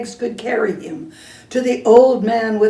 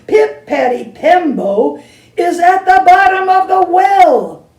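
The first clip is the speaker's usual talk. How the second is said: much louder, higher desperate shout; boy shouting with his last breath; 6 semitones higher, 8 dB louder than usual. Acted. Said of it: "he shouted".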